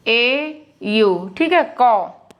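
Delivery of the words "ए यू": ए यू spelled out very slowly, letter by letter; slow spelling. Shown as a demonstration. Teacher spells a vowel sign slowly for pupils. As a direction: drag out each letter for the class. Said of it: "spelling out letters slowly".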